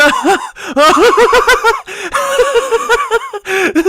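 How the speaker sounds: very high, loud helpless laughter; riotous guffaw. Acted. Tickled pony guffaws in midair, very high and loud. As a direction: burst out laughing while being tickled. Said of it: "Barry guffawing riotously".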